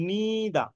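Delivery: "neither" as a slow, stretched NEEE-da; British pronunciation. Shown as a demonstration. Teacher sounding it out for students, "neither" as long NEE-da. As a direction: draw out the NEE in "neither", ending -da without r. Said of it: "দন্ত ন দিকারে নি".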